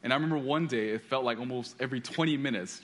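ordinary talk

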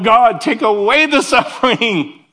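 high, slow pleading with a laugh; mock whiny prayer. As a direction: beg God like a wimp, laughing. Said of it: "that's how I kind of pray".

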